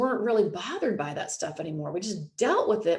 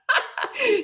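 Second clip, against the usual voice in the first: high burst of laughter; high giggle after the quote; real laugh; 3 dB louder than usual. Real, not acted. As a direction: laugh out loud at the joke. Laughing at own impression.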